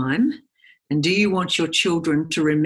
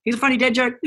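playful dad; 6 semitones higher than usual. Retold or imitated. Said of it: "the younger one and go".

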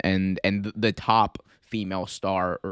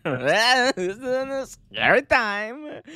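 high, slow, wavering mock-ghost call; spooky Halloween voice; 10 semitones higher, much slower than usual. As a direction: draw it out eerily like a playful ghost.

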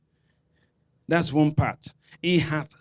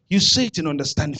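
ordinary delivery

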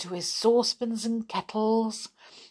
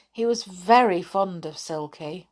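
ordinary delivery, nothing marked

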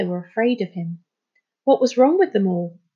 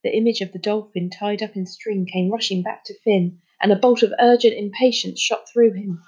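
plain reading voice

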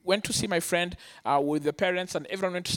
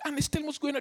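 higher-pitched worried plea; imitated tearful pleading; 6 semitones higher, 3 dB quieter, faster than usual. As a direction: act out a crying girlfriend. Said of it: "and she's crying".

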